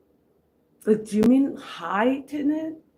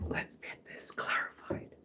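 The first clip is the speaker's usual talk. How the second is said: whisper; 16 dB quieter than usual. Real, not acted. Whispered aside.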